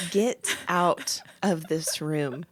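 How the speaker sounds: low, soft, very slow order; cold, controlled fury. Retold or imitated. Wife staring at husband after his advice, cold controlled fury. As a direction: spell it out with quiet menace.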